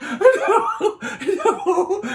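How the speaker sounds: words squeezed out through high laughter; giggling fit; laughing. Real, not acted. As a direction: laugh helplessly while saying it. Cracking up at own joke.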